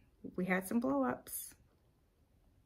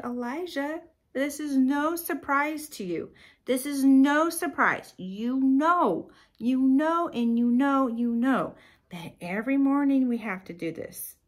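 raised, emphatic motherly scolding; getting into him; 5 semitones higher, 6 dB louder than usual. Retold or imitated. Mother getting up in her son's space, firm scolding. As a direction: lay down the law, leaning hard on key words. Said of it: "really get into him".

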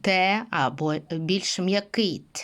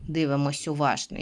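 usual speaking voice

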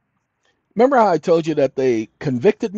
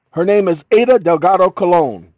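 usual speaking voice

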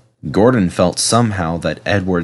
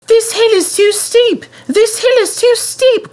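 very high, squeaky grumble; grumbling coaches; 3 dB louder than usual. A narrator's reading. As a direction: complain in tiny coach voices. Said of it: "grumbled the coaches".

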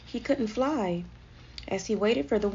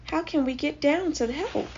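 pitched-up worried question; 7 semitones higher than usual. A narrator's reading. Giraffe asking the animals at the cliff, worried. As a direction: fret aloud in a higher voice. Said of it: "worried the giraffe".

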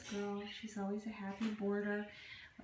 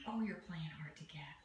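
whisper; 6 semitones lower, 6 dB quieter than usual. Real, not acted. Whispering to a shy cat.

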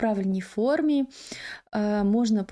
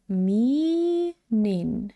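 "Meaning" drawn out slowly, syllable by syllable; slow demonstration. Shown as a demonstration. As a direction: stretch "Meaning" out for learners. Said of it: "дивимося". (повільно, розтягнуто "Meaning")

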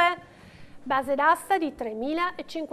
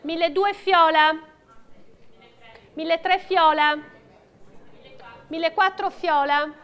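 steady rhythmic bid calling; auctioneer calling bids; 4 dB louder, slower than usual. Real, not acted. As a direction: call out each bid to the room.

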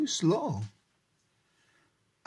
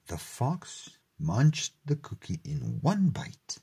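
usual speaking voice

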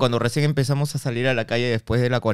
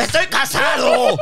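high, drawn-out comic wail; mock outraged protest; 10 semitones higher, 3 dB louder, slower than usual. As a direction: plead your case in exaggerated alarm. Acts out protesting he is married. (protesta aguda y exagerada, en broma)

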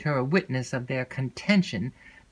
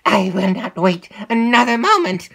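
louder, sharp impatience; impatient; 6 semitones higher, 8 dB louder than usual. Acted. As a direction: snap out the ultimatum. Mistress loses patience.

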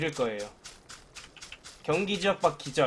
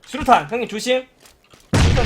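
shouted warning; 6 semitones higher, 9 dB louder than usual. Real, not acted. Warning teammate of a grenade.

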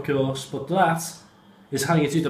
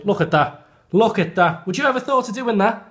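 louder, pushy host; hammed-up showing off; 3 semitones higher, 5 dB louder than usual. Host showing the model's huge lips, loud and proud. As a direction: show it off proudly.

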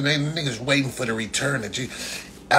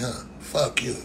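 ordinary talk

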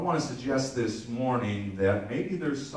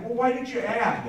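pitched way up, louder asking why; teacher scolding the class, higher and louder; mock teacher retort; 11 semitones higher, 5 dB louder than usual. A teacher's exasperated retort to the silent students, raised and louder.

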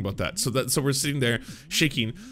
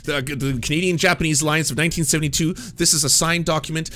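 loud, indignant bluffing rant; blustering threat; 4 semitones higher, 4 dB louder than usual. Retold or imitated. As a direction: threaten them with invented treaties. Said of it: "I was just spouting bullshit at this point".

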